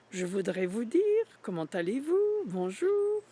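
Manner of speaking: playful French sing-song; French demo. Shown as a demonstration. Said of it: "if you hear French, it sounds musically".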